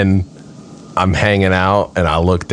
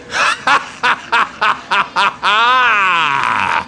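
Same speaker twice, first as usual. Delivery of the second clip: long shouted laughing cackle; villain laugh; 12 semitones higher than usual. Pretend. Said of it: "like that".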